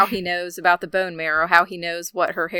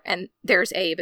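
ordinary talk, nothing marked

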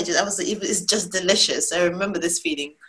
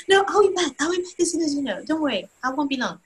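lightly voiced husband, higher and easing down; reassuring husband; 6 semitones higher than usual. Husband leaving to fetch daughter, lightly imitated reassurance. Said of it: "he's like".

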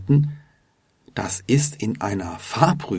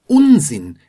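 loud, higher dismissive burst; indignant retort; 4 semitones higher, 8 dB louder than usual. A narrator's reading. Examiner rejects learner's excuse, indignantly. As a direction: cut him off with a cross denial. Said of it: "entgegnet sie".

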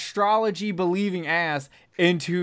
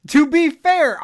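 excited; 11 semitones higher, 8 dB louder than usual. Real, not acted. Joking comeback among laughing friends.

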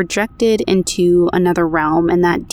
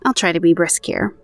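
ordinary talk, nothing marked

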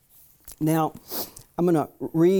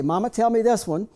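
put-on higher, louder little boy's eager plea; child asking; 7 semitones higher, 4 dB louder than usual. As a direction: ask like a small boy holding up a photo. Child asking mother about photo.

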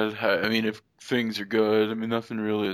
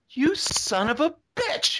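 mock curse; slower than usual. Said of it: "I wanted her to turn around and go".

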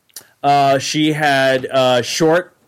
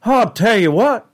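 Hank Hill; 7 semitones higher than usual. Shown as a demonstration. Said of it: "sophisticated redneck, which is literally just Hank Hill".

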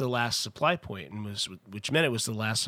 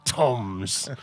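lightly done pirate voice, a bit lower; 4 semitones lower than usual. Pretend. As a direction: say it like a playful pirate. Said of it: "That's an underrated pirate voice word".

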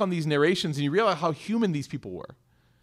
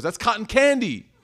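daughter's correction; 7 semitones higher, 5 dB louder than usual. Retold or imitated. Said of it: "She goes".

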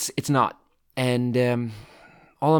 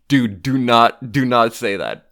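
slow, louder, every word pressed; slow firm warning; 7 dB louder, slower than usual. Retold or imitated. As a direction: lay down the law, word by word. Warning coworker not to say it.

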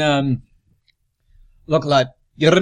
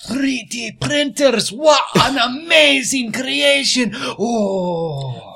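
amazed gasp; 7 semitones higher, slower than usual. Acted. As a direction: gasp in awe at the machines. Character gasps at factory.

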